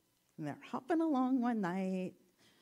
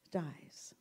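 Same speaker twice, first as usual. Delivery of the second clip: hushed; 5 semitones lower, 8 dB quieter than usual. A narrator's reading. Hushed death in the story.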